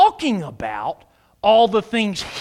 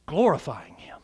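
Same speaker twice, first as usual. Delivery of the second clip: ordinary talk, nothing marked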